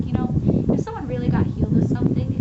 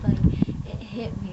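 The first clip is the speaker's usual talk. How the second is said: ordinary delivery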